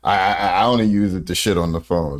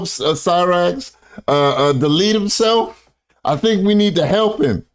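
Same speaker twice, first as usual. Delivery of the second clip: mocking taunt; 8 semitones higher than usual. Reactor jeering at the man on the clip, mocking. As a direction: taunt him in a put-on high singsong.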